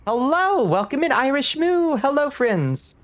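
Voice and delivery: high, bright-voiced streamer hello, slowing on friends; cheery high welcome to the chat; sing-song greeting. Real, not acted. Greeting viewers arriving from raid.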